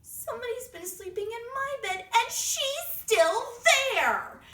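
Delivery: very high, loud little bear cry; Baby Bear. Acted. Baby Bear spotting Goldilocks in his bed, high-pitched alarm. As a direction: squeal it, alarmed, stretching to the end. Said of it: "Baby Bear looks at his beautiful sleigh bed and he says".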